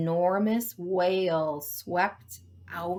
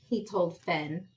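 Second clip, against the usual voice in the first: usual speaking voice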